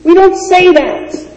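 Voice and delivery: high, loud, slow scandalized shout; stepfather's rebuke. Retold or imitated. Stepfather in synagogue scolding the boy, high loud shout. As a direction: bark it like a shocked stepfather forbidding the word. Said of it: "Gee, he looked".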